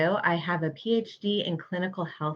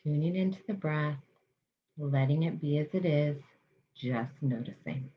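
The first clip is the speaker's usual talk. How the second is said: guided meditation. Acted. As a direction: ease listeners into calm, unhurried.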